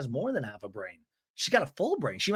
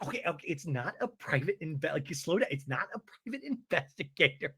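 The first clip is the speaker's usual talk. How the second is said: softer, halting mock backpedal; 7 dB quieter than usual. [name] corrects herself about the investigator, soft halting backpedal. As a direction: stammer it softly, imitating someone caught out.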